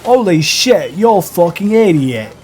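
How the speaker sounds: angry band. Retold or imitated.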